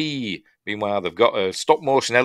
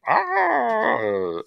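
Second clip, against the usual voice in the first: high mock scream; a short hammed-up cry of terror; 12 semitones higher than usual. Dwarf caught in the beast's gob, high mock scream.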